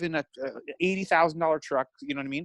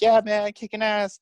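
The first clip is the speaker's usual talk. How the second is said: higher, louder hyped-up fan voice; mock excited fan; 7 semitones higher, 4 dB louder than usual. A man's fans cheering him on, mock-hyped. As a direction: ham up a pumped supporter. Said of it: "and they're like".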